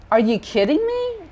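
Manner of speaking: high, incredulous question; acted disbelief. Preacher voices God taking distrust personally, incredulous. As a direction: toss it out high, as if affronted. Voicing God's disbelief at people.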